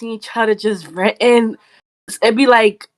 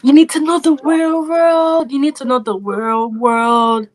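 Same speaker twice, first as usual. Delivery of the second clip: mocking naysayers; 5 semitones higher than usual. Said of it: "people are always saying".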